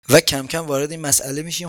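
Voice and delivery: ordinary talk, nothing marked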